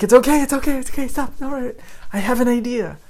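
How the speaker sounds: high-pitched, hurried soothing, loud at first; flustered reassurance. Retold or imitated. Teacher to crying student, flustered mock reassurance. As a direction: imitate a panicked attempt to calm someone.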